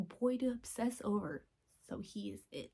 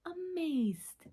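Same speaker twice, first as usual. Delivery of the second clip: amazed; 8 semitones higher than usual. Retold or imitated. Recalling watching amazed.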